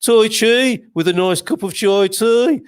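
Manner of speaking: high, slow, exaggerated Brummie accent; lilting Birmingham accent, drawn out slowly. Shown as a demonstration. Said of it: "Probably even better in a Brummie accent".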